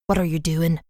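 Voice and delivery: a touch of menacing growl. Acted. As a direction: growl the question at her. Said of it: "Father Murphy growled".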